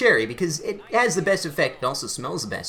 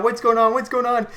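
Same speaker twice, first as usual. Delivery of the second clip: alarmed, higher and louder call; panicked mom; 6 semitones higher, 4 dB louder than usual. Retold or imitated. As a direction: mom rushing in, voice lifted in alarm. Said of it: "she's freaking out".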